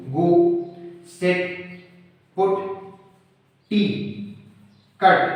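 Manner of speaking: "गो, सेट, पुट, टी, कट" as single beats with pauses between; one syllable each. Shown as a demonstration. Teacher showing one-syllable words, "गो, सेट, पुट, टी, कट" isolated beat by beat. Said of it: "सिर्फ एक ही tone में उनको बोल पाएं".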